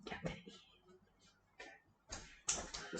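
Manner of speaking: hushed, breathy whisper. Real, not acted. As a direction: whisper it like a secret. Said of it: "Why are we whispering?".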